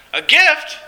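high, puzzled question; perplexed. Acted. Woken man to friends offering a gift, perplexed. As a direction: ask it in baffled surprise, pitch jumping up.

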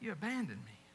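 soft, low, wounded; God to the faithless bride, hushed and hurt; hurt husband. Acted. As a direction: murmur it as a broken-hearted accusation. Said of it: "God says".

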